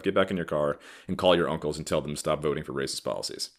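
normal talking voice

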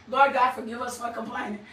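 soft, low, quick prayer; hushed prayer. Real, not acted. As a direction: murmur a quick plea to God. Brief prayer aside.

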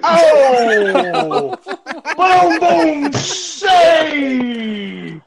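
loud, high, laughing mock cry of triumph; hammed-up shout. Laughing at his own toilet story, a loud mock cheer. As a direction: belt it out like a silly victory yell.

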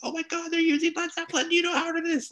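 squealing high excited voice; thrilled falsetto gush. Retold or imitated. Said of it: "I was like".

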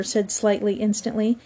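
ordinary delivery

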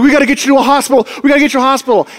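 hammed-up panic, insisting on help; mock freaked out. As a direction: imitate a frantic driver rushing the words. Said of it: "he's freaked out".

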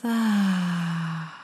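audible release through the mouth; big relaxed sigh. Shown as a demonstration. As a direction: let the breath fall out with a sigh. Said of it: "with a big sigh through your mouth".

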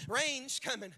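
normal talking voice

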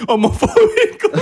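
high, slow word broken by a laugh; laughing tease. Real, not acted. Friend teasing him about a post, laughing.

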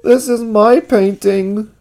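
high, slow, upset character voice; drawn-out high acted distress; tearful confession. A narrator's reading. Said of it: "burst into tears".

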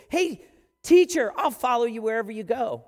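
loud, eager call to the teacher; eager volunteer. Acted. Scribe calling out to Jesus, loud and eager. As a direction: call out keen to sign up.

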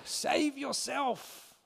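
slow, high jeering taunt; mocking. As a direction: sneer it out slowly. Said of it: "they mock".

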